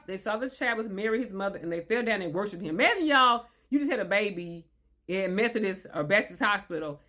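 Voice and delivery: ordinary delivery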